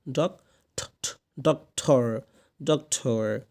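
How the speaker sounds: ডক্টর broken slowly into dok and thor; syllable by syllable. Shown as a demonstration. Teacher breaks it down for learners, slow split ডক্টর. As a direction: isolate the aspirated T in ডক্টর. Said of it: "কি হবে?".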